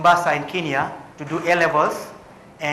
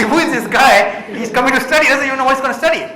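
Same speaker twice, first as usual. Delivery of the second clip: higher, louder mock disbelief; incredulous officer; 7 semitones higher, 5 dB louder than usual. Immigration officer scoffing at the clueless student, louder and higher.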